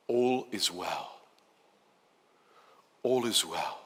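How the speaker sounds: slow, soft and low reassurance; calm the listener with each word; soothing demo. Shown as a demonstration. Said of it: "The tone of my voice".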